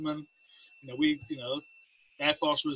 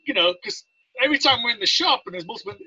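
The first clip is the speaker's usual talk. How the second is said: ordinary talk, nothing marked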